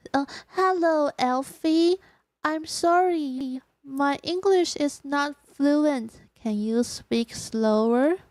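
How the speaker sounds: slow, deliberate English to an elf; halting, polite request in English; careful learner's English. Pretend. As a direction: spell it out slowly, word by word.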